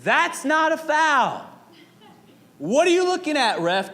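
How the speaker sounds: high, loud mock yell at the ref; mom yelling at ref. Basketball mom in the stands yells at the ref, loud mock yell. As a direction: imitate a sideline mom losing it. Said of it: "kept saying".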